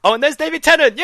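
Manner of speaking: excited shout. Real, not acted. Excited cheer at David Tennant.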